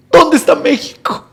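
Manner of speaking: high, pleading wail; imitated heartbroken wail. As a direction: act out an anguished question. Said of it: "el corazón se le desgarraba".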